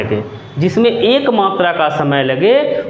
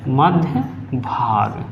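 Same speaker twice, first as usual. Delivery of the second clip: slow, soft dictation-style repeat; slow repetition; 6 semitones lower, 5 dB quieter, much slower than usual. Shown as a demonstration. Teacher slowly repeating term for note-taking. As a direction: say मध्य भाग slowly for students to copy. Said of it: "हम बोलते हैं मध्य भाग".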